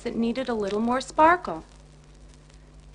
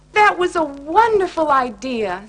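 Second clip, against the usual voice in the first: enthusiastic; 5 semitones higher, 10 dB louder than usual. Acted. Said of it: "said Love-a-Lot Bear".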